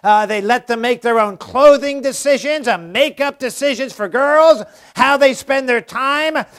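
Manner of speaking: ordinary talk, nothing marked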